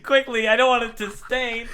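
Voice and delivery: high, loud pleading through laughter; hammed-up high-pitched urgency; mock panic. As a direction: play a comically desperate cleanup order. Mocking bleeding pirate's urgency.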